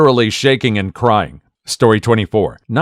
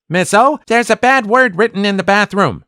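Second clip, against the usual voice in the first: put-on kid voice; 10 semitones higher than usual.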